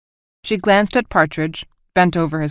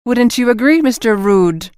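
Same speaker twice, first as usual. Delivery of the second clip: higher, louder mock Scottish accent with Rood said like rude; bad Scottish accent; 5 semitones higher, 4 dB louder than usual. Captain teasing Mister Rudd in a mock Scottish accent. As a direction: keep the terrible Scottish accent going. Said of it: "She pronounced it rude".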